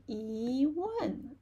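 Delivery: yi drawn out and rising, wan falling. Shown as a demonstration. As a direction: slowly lift yi, then drop wan. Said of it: "rising tone".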